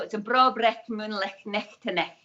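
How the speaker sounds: broad Scottish accent with rolled vowels; thick Scottish accent. Shown as a demonstration. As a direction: say it like a true Edinburgh Scot. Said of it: "I can put on the thick Scottish accent".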